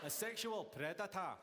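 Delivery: slow, leering Scottish drawl on sexual predator; mock Scottish accent. Comic copying the audience member's Scottish accent. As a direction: roll it out in a thick Scottish accent. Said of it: "sounds better in your accent than any other".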